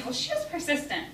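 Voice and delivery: ordinary delivery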